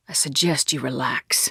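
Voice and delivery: whisper. Acted. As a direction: warn him under your breath. Whispered threat.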